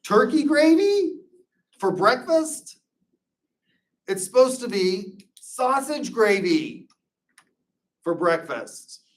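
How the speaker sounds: high, loud, slow indignant disbelief; hammed-up outrage at the wrong gravy; mock outrage. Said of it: "And I'm like".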